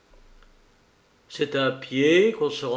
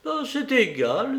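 high-pitched, insistent lament; stubborn old lady; 9 semitones higher than usual. A narrator's reading. Madame Dor clings to her idea, high and insistent. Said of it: "Puis revenant à son idée".

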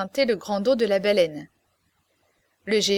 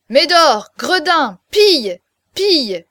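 loud, higher angry commands; furious shouting; 5 semitones higher, 9 dB louder, slower than usual. A narrator's reading. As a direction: scream at the disloyal dog. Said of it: "faisait de son côté le géant".

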